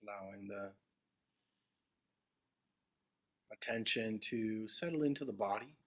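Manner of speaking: unhurried meditation guidance with long silences; guided meditation. Acted. Teacher guiding the group into meditation, spacious and measured. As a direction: settle listeners gently, phrase by phrase, leaving long pauses.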